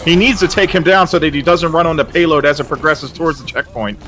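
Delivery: breathless fast call; racing out of air; out of breath. Real, not acted. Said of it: "Oh my god, I'm running out of breath".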